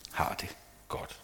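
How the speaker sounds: whispered, quiet ending. Real, not acted. Preacher whispering to the congregation whether we feel well. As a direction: drop to a whisper on the key point. Quiet point about wellbeing.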